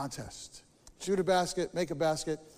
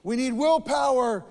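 Frog, higher and louder, insisting; Frog declaring; 7 semitones higher, 8 dB louder than usual. Acted. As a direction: announce it like a solemn decision, mouth full of cookie. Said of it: "Frog finally says".

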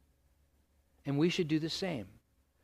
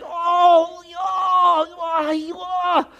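high, loud hammed-up groans of despair; mock moaning; 14 semitones higher, 10 dB louder, much slower than usual. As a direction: wail like you've given up. Acted worrying on the ground.

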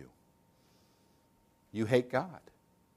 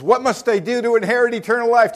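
quick, louder, higher eager question; eager young ruler; 8 semitones higher, 8 dB louder, faster than usual. Retold or imitated. Rich young ruler asking Jesus, eager and quick. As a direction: rush it like a keen young man.

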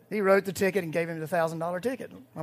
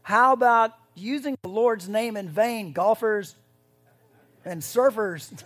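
loud, higher jab at the golfers; teasing call-out; 5 semitones higher, 9 dB louder than usual. Real, not acted. Preacher teasing the golfers in the pews, loud and high. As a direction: call them out with a grin.

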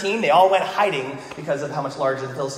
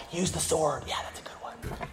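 lightly voiced hushed caddy; golf caddy; 11 dB quieter than usual. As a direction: softly offer discreet advice, trailing to a whisper. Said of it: "So he's just telling Saul".

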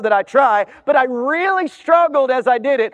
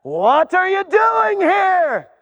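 higher, slow, drawn-out disbelief; baffled gatekeeper; 6 semitones higher, slower than usual. Acted. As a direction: stretch out the bewildered question.

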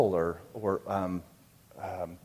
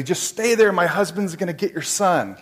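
louder, higher imitation of an urgent wife; urgent calling out; 5 semitones higher, 4 dB louder than usual. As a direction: call firm reassurance to someone across the way. Wife calls to the mother urgently.

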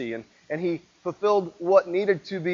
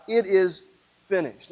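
normal talking voice

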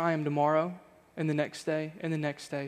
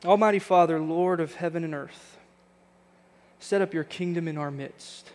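prayer. Acted. Pastor praying for church at service close, earnest prayer voice. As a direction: pray it over the congregation, measured and sincere.

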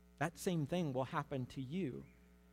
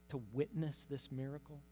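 ordinary delivery

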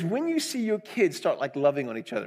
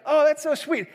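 a lighter high coo, louder; mock doting teacher, less teary; gushing teacher again; 10 semitones higher, 5 dB louder than usual. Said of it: "You probably don't sound like that".

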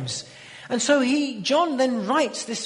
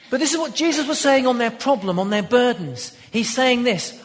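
full-force preaching; 4 semitones higher, 6 dB louder than usual. Acted.

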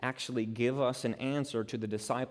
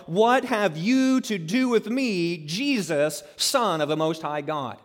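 loud mock scream of defiance; retold defiant scream; 5 semitones higher, 5 dB louder than usual. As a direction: call it out defiantly, like a demoniac confronting Jesus. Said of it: "he starts screaming out".

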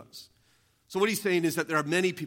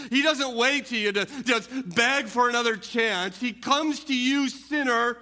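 preaching at full force; 5 semitones higher, 4 dB louder than usual. Acted. Preacher appeals to the congregation, rising and impassioned. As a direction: press the promise home to the sinner.

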